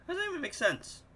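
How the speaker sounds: high, disbelieving question; incredulous. Real, not acted. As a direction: ask it in total disbelief.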